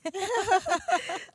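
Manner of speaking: high, loud disbelieving laugh; incredulous laugh. Retold or imitated. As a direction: laugh out in shock. Reacting to doctor's news.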